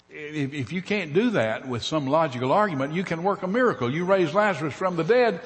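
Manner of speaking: a follower pleading with his Lord; urgent pleading. Acted. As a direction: beg him to do something. Pleading with Jesus to act.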